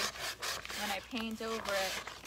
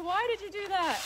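mock angry dad; 8 semitones higher, 5 dB louder than usual. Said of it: "He's like".

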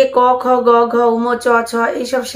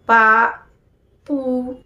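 slow drawn-out syllable; much slower than usual. Shown as a demonstration. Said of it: "সেটি আমি বলছি, শুনুন".

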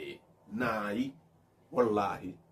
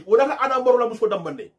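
normal talking voice

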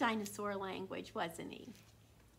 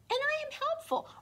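much higher, louder proud dinosaur; dinosaur's voice; 5 dB louder than usual. A narrator's reading. Reads the dinosaur's first-person lines.

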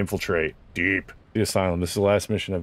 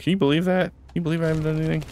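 higher, playful indignation; mock disbelief; 8 semitones higher, much faster than usual. Real, not acted. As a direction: pretend to be shocked at yourself. Mock outrage at teammates.

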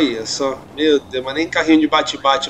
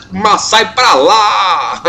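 laughing taunt; 3 semitones higher, much slower than usual. Acted. Shoving rival car off road.

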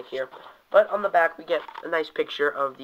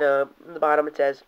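usual speaking voice

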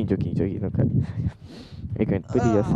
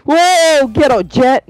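excited yell; 13 semitones higher, 5 dB louder, much slower than usual. Real, not acted. Cheering after a kill.

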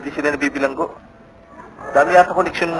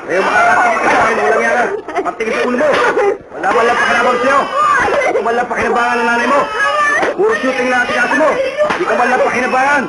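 scolding; 6 semitones higher than usual. Acted. Mother scolds useless son.

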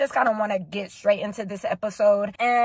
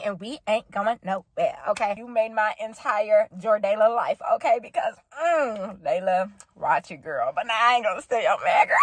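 ordinary talk, nothing marked

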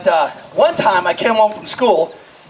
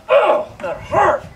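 pained surprise; 10 semitones higher, much slower than usual. Retold or imitated. Reaction to knife hit.

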